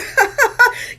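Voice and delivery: squealing laugh at her own joke; giggling about being a Leo; high burst of laughter. Real, not acted. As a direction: let a high laugh break out.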